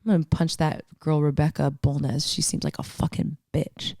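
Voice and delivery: low, hushed tough-guy impression; mild mock menace; mock thug quote. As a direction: say it like a menacing stranger, under your breath. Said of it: "that guy wasn't like".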